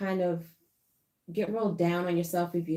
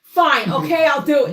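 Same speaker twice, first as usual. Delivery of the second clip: mock surrender; 8 semitones higher, 12 dB louder than usual. Mock giving in, laughing.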